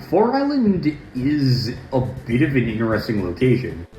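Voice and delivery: ordinary delivery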